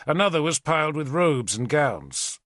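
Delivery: ordinary delivery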